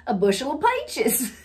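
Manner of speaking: down-home Southern "pie-ches" for "peaches"; Southern accent. Acted. Pulling off down a country lane, Southern accent. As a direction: drop into Southern country roots. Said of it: "I just drop down into my roots".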